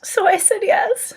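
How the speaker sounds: emotional. Real, not acted. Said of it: "make me emotional".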